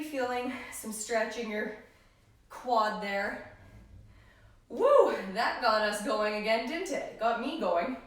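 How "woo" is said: loud, high "woo"; exhilarated whoop. Real, not acted. Trainer still buzzing from the workout, exhilarated whoop. Exhilarated after the hard set.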